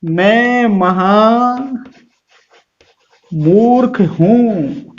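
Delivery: महा मूर्ख stretched out very slowly, weight on मूर्ख; slow, heavy stress on मूर्ख with a falling end; drawn-out emphasis. Shown as a demonstration. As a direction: dwell on महा and land on मूर्ख. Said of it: "इसका आपको sense लेना पड़ेगा".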